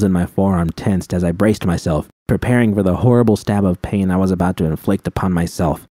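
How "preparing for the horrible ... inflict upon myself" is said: usual speaking voice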